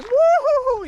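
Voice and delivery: high, loud whooping cheer; hammed-up carefree yell; wild whoop. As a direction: cut loose with a joyful woo-hoo. Same cheer repeated.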